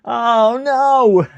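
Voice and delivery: very high, loud dismayed oh no; the sinking realisation, cried out. Real, not acted. Streamer realising his mistake, high and loud.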